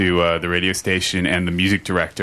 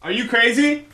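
playful high voice. Pretend. Playfully calling to DJ Loco.

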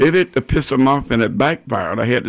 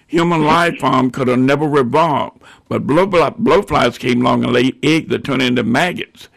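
ordinary talk

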